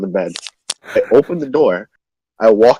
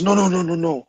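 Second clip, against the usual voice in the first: put-on drunk hushed insistence; 4 semitones higher than usual. Drunk roommate insists he stay.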